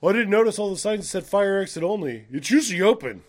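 loud, high feigned innocence; hammed-up lame alibi; mock excuse. Mocks the fire alarm excuse.

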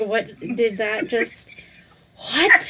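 disbelief breaking into a laugh; stunned disbelief. Retold or imitated. Friend recalling the yes, whisper to a laughing cry. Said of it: "my brain exploded".

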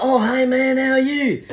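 put-on chummy high drunk bloke; high friendly drunk voice. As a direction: pitch up high, overly friendly slurred greeting. Drunk stranger voiced in story.

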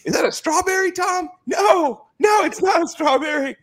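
high-pitched shouting caricature; shrill mock voice. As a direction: shriek it out in a high put-on voice. Voicing Giselle and Brady in shrill voice.

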